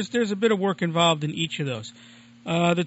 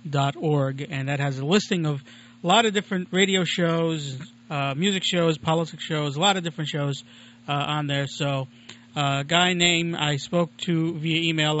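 ordinary talk

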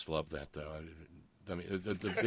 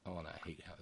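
ordinary delivery

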